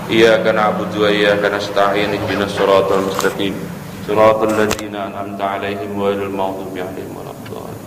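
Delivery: low, level Quranic recitation; prayer recitation. Real, not acted. Preacher leads congregation in Al-Fatihah, low level recitation. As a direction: recite steadily on a low, even pitch.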